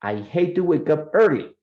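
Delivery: early stressed with a falling finish; emphasis on key words. Shown as a demonstration. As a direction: model the sentence with lively stress.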